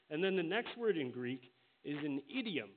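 usual speaking voice

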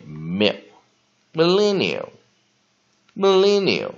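mil- first, then millennial drawn out with stress on len; patient teacher demo of millennial; slow model pronunciation. Shown as a demonstration. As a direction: build up millennial from its first syllable, slowly. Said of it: "我们就看后面lineal".

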